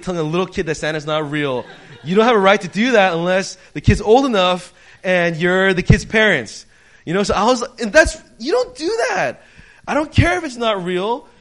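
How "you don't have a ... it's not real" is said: loud, high indignant rant; mad. Retold or imitated. Customer scolding staff for spoiling the game, loud and indignant. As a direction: scold with rising outrage. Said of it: "And I was so mad".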